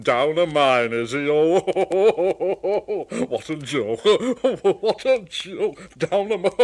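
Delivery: big booming laughter through the words; laughing Gordon. Acted. Gordon laughing at Thomas stuck down a mine. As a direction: chortle at the joke. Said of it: "he started to laugh".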